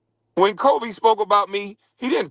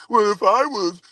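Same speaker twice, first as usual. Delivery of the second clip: mocking McGrady; 5 semitones higher than usual. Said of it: "now imagine Kwame Brown saying that".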